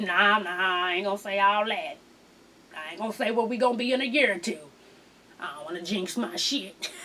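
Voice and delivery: low, subdued mock refusal; muted refusal. Boyfriend refusing to talk about the future, subdued refusal. Acting out reluctant man's reply.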